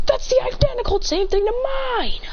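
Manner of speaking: very high-pitched protest; hammed-up shocked outburst; mock astonishment. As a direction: exclaim in cartoonish disbelief. Shocked at identical power.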